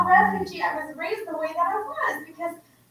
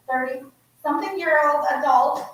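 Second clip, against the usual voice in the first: ordinary talk, nothing marked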